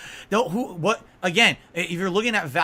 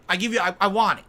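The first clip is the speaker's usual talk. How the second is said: ordinary talk, nothing marked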